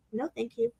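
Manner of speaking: soft, simple polite no; quiet calm refusal. Retold or imitated. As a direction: say it softly without pushing. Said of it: "It was just so".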